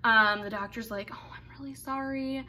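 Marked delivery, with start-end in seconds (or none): 1.1-2.4 soft unvoiced whisper of sorry; doctor whispering an apology to her patient. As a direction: breathe the apology out, barely voiced. Doctor apologizing after failed procedure.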